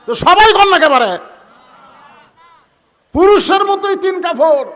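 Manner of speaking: very loud, high preacher's shout; shouted demand. Real, not acted. Preacher pressing the crowd to answer, high loud shout. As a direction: holler to rouse a silent congregation.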